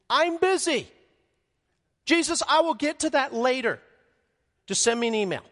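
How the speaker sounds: high, loud put-upon busy voice; dismissive Gabriel. Acted. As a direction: play a lazy angel waving off his boss. Gabriel brushing off Jesus.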